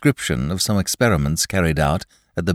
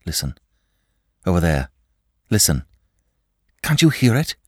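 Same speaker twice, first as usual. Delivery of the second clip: frightened; faster than usual. A narrator's reading. Shell-shocked master hears tapping.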